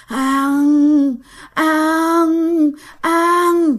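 lightly voiced hungry ghost moan, rising; a creeping spirit begging to eat. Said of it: "vừa bò vừa kêu". (giọng quỷ đói rên nhẹ)

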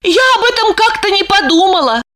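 high, frantic inner worry; anxious wife. A narrator's reading. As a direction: spiral into panic.

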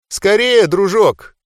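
high, loud mocking hurry-up. A narrator's reading. Mentalist hurrying the boy to choose, mocking taunt. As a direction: sneer as you rush him. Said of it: "с издёвкой поторопил меня менталист".

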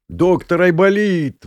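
loud, drawn-out high greeting; exclaiming. A narrator's reading. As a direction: hail the newcomer slowly and loudly. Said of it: "воскликнул Ромка". (протяжно, громко восклицая)

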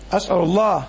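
ordinary talk, nothing marked

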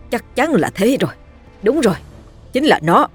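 excited realization. A narrator's reading. Villain realizes the source.

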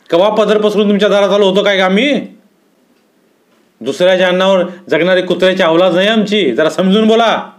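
sustained high-pitched angry retort; indignant storyteller tirade; flaring defiance. A narrator's reading. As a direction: warn him off, wounded pride and all. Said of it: "आणि हिंदुरावही भडकला".

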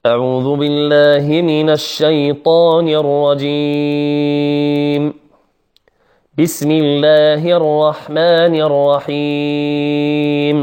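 slow chanted tajweed recitation; measured, melodic opening invocation; Quran recitation. Shown as a demonstration. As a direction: recite it slowly with long held final vowels. Opens recitation of the Fatiha.

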